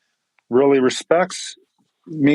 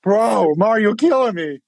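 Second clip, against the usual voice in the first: higher, choked-up mock protest; overwhelmed exclamation; 6 semitones higher than usual. Pastor to young mentee reading a letter, overwhelmed. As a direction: raise the voice as if close to tears. Retold emotional reaction to letter.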